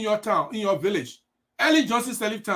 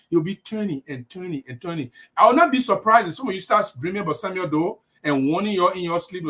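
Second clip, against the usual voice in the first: usual speaking voice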